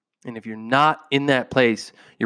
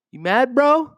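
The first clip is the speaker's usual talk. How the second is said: teasing taunt; 10 semitones higher than usual. Pretend. Teasing the listener.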